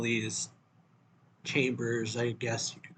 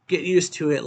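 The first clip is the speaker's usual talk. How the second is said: higher and louder, cold scolding; harsh retort; 5 semitones higher, 8 dB louder than usual. Acted. Noya harshly telling Shira to get used to it. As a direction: slap her complaint down.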